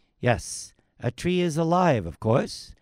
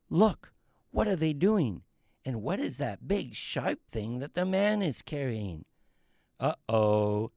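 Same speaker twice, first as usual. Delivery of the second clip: ordinary talk, nothing marked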